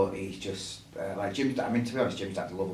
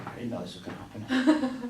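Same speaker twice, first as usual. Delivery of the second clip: son impression; 3 dB louder than usual. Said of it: "where he's like".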